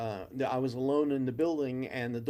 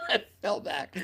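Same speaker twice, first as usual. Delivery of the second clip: ordinary talk, nothing marked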